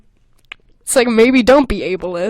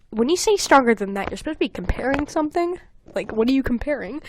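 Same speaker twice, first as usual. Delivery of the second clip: usual speaking voice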